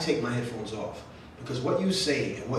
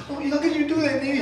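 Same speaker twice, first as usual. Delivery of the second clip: higher, louder imitation of mom; mother answers her child in a higher, louder put-on voice; 5 dB louder than usual. Mother voiced in family story.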